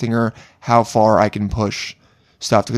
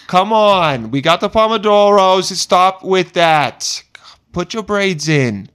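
loud, high Brooklyn Italian mom, very slow at times; hammed-up Italian mother bossing her daughter; 6 semitones higher, 4 dB louder, slower than usual. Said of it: "I'm just making up Italian stereotypes".

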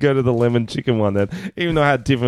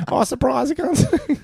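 mock surprise.

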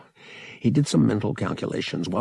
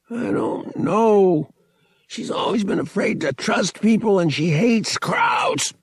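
pressured cousin Thraken; 12 semitones higher than usual. Acted. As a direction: answer under duress, voice raised high. Cousin pinned, pressed for answers.

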